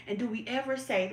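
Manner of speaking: normal talking voice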